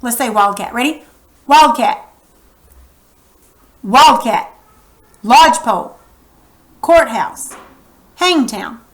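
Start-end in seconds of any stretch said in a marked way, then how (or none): 1.5-4.5 Wildcat with a loud WILD, falling on cat; stress on first part. Shown as a demonstration. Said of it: "See how we always say these louder?". 5.2-8.8 Lodgepole, Courthouse, Hangtown with LODGE, COURT, HANG strongest; first-part stress. Shown as a demonstration. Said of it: "See how we always say these louder?".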